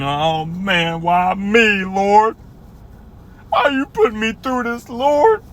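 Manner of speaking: high, whiny plea to God; a sulky believer whining at God; whining complainer. Acted. Acting a pouting believer.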